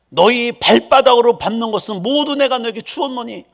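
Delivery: a preacher thundering a verse; proclaiming scripture. Acted. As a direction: declare God's promise boldly.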